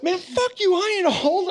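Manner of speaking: high, loud indignant yell; mock angry shout. Dad yelling back at teens who called him old, mock angry shout. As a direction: fire back, wounded pride. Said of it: "And I got mad".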